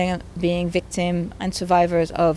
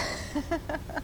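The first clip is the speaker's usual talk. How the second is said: teary laugh; 9 semitones higher, 14 dB quieter than usual. Real, not acted. Laughs through tears.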